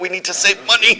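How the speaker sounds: high, strained mock-dying insistence; dying demon general. Dying general insists on thrift, high strained voice. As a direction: push out hammed-up last words up high. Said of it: "he's like".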